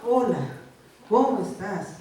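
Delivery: loud, curt mock hello; hammed-up brusque greeting to an all-too-familiar spouse; long-married greeting. Spouse of thirty years in a joke, brusque greeting. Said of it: "ya llevan treinta años de casados".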